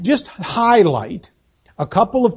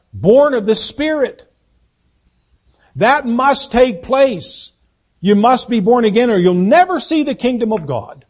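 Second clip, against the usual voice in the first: preaching at full force; 4 semitones higher than usual. Acted. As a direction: drive it home to the pews. Sermon climax.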